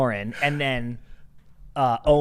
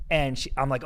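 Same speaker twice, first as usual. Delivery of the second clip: ordinary delivery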